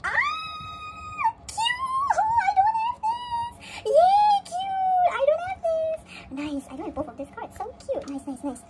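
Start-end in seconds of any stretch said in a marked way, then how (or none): 0.0-6.0 very high, loud screaming glee; ecstatic squeal. Real, not acted. Collector getting cards she lacks, ecstatic squeal. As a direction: squeal every word in delight. Pulls two missing cards.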